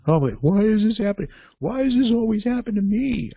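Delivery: very high-pitched whiny imitation; exaggerated self-pitying whine; mock whining. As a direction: wail it like a hammed-up victim. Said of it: "I know people like that".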